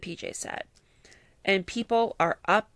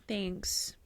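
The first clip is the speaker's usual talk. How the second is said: flat thanks; 8 dB quieter than usual. Retold or imitated. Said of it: "And they said".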